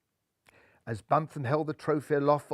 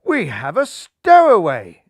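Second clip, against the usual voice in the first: louder, slow declaration; alarmed announcement; 2 semitones higher, 11 dB louder than usual. Acted. Skipper telling everyone about the stowaway, loud and slow.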